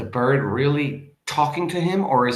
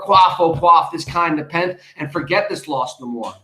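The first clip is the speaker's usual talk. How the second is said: dramatic poem reading; 5 semitones higher, 5 dB louder than usual. Acted. Teacher reading Poe to the class, declaiming. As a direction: read the lines like a stage poet.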